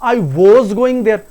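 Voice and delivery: "was" stretched to "vohz"; wrong pronunciation. Shown as a demonstration. Teacher showing the second mistake, mispronounced. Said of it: "नहीं, that is simply".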